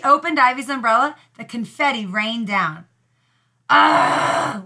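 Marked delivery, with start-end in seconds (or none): 3.7-4.6 disgusted ugh. Acted. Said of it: "wailed Zelda".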